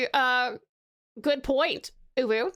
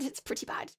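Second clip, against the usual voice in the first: hushed, self-deprecating echo; whispered aside; 11 dB quieter than usual. Real, not acted. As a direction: drop to a near-whisper. Muttered repeat of own verdict.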